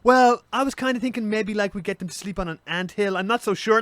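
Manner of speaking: high-pitched, tentative mock committee member; hesitant elder. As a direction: offer it unsurely, pitched well up. Acted hesitant elder at meeting.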